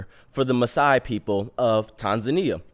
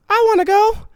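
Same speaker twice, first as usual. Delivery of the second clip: high, loud mom voice calling out; 6 dB louder than usual. Retold or imitated. Mom's voice calling from afar.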